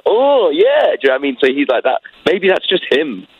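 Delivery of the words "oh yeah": [name] ad-lib. Retold or imitated. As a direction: belt it like a rap ad-lib. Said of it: "in the background of the track going".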